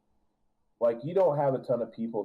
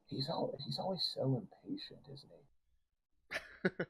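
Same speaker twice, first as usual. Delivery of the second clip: soft, higher, knowing aside to the player; hushed higher goddess aside, amused; teasing aside; 8 semitones higher, 11 dB quieter than usual. Acted. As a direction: let her lean in and tease about the god. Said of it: "she goes".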